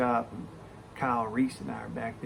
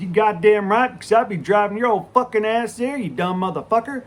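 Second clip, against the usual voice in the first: high, booming comeback; loud mock-insult retort; 9 semitones higher, 11 dB louder than usual. Younger coworker topping his buddy's fight talk, loud cocky retort. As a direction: fire back loudly at an old sparring partner. Instant comeback in retold bickering.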